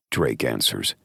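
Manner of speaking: ordinary delivery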